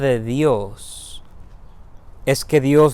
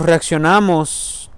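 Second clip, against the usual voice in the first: usual speaking voice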